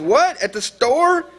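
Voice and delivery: very high, incredulous questions; imitated impatient dispatcher. Dispatcher questions the caller about the store, imitated impatience.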